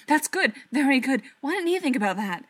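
urgent burst of approval; excited realisation. A narrator's reading. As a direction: seize on a sudden idea, rushing at the end. Character's excited realisation amid the battle.

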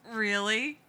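barely keeping a straight face; strained, holding back laughter. A narrator's reading. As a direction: brother trying not to crack up. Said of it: "clearly strained".

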